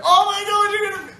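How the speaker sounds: imitated shriek, very high and loud; going nuts. Grandson imitates his Lola hearing the audition news, going nuts. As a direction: squeal it as an overjoyed grandma. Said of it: "Going nuts".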